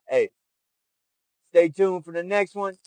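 ordinary talk

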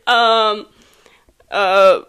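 loud cartoon villain; Despicable Me villain voice. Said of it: "I have no more Despicable Me".

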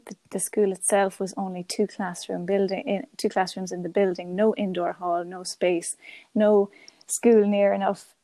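usual speaking voice

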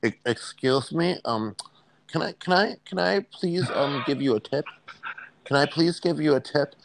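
smug nitpicker offering an unwanted tip; Mister Know-It-All voice. Pretend. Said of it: "in a real Mister Know-It-All voice".

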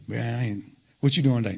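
soft, mumbled idle question; lazy friend. Retold or imitated. Preacher imitating an idle friend asking, mumbled soft. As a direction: toss it off low like a bored friend.

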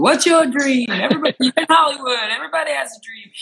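high, quick movie-line impression; Pretty Woman quote. Quoting Pretty Woman about Hollywood dreams, high movie voice. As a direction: call it out high and lively, like quoting the film. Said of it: "like on Pretty Woman".

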